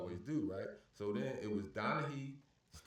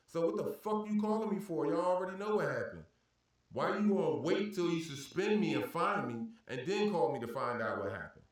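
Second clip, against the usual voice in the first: angry rant; 6 semitones higher, 4 dB louder than usual. Retold or imitated. Retold rant at league security.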